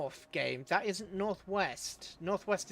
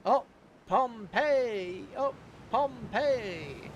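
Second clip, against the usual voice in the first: loud delighted shout of the name, the second one drawn out slowly; excited cheer; 5 semitones higher, 3 dB louder, much slower than usual. Real, not acted. Gamer cheering a Pompeii event to the stream, delighted and drawn out. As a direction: cheer the name out, then stretch it long.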